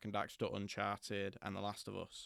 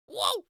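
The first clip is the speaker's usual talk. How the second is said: high squealing whoa; Crash whoa; 10 dB louder than usual. Pretend. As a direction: yelp it like Crash spinning. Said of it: "what was the one thing I said I was gonna do".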